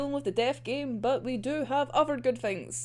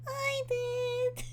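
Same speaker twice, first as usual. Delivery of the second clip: high, quiet smug reply; 12 semitones higher, 6 dB quieter than usual. Real, not acted. Host gloating about calling it, quiet and high.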